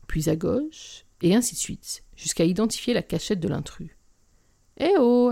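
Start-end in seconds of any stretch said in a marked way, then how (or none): 4.8-5.3 higher, louder hail; calling out. A narrator's reading. Narrator hails an unseen intruder in the alley, calling out. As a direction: call out to whoever is hiding there. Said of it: "appelai-je".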